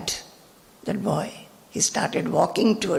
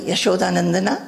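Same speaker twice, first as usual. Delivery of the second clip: ordinary delivery, nothing marked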